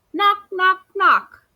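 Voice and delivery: loud, high knocking call. A narrator's reading. Narrator knocks at Amu's door, loud. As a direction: rap it out like a knock.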